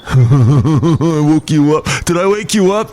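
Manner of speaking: loud, slow taunting; mock gloating caller. Sarcastic friend calling at four in the morning, slow gloating taunt. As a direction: gloat over waking him. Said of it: "That was his first question".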